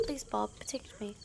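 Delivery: whispered plea; scared whisper. Real, not acted. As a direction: hush it, frightened. Frightened plea to companion.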